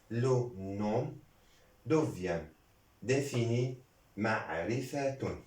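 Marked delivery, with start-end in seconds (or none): none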